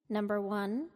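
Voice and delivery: ordinary delivery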